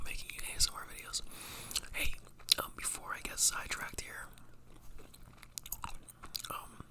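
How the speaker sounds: soft ASMR whisper; breathy whisper to the mic. Real, not acted. Whispers all through.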